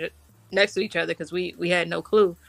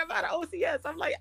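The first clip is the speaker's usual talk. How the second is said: ordinary talk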